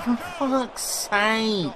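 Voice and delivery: slow, high, soft drawn-out groan; odd put-on voice. Real, not acted. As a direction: moan it in a strange put-on voice, very slowly. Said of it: "I don't know why I'm talking like that".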